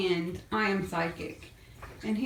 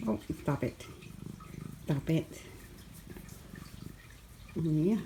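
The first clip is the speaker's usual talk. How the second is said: soft scolding; 5 semitones lower, 10 dB quieter than usual. Real, not acted. Gently scolding the kittens.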